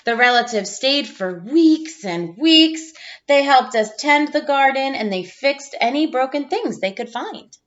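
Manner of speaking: put-on gentle storybook read-aloud for young listeners; reading to children. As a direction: read it like a teacher sharing a picture book with the class. Reads picture book to class.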